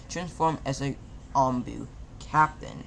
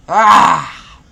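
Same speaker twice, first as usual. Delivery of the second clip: loud raw scream of grief; anguished scream; 4 semitones higher, 18 dB louder than usual. A narrator's reading. Naruto screaming over Hinata's body, anguished. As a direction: let out a howl of pain. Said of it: "Naruto screamed".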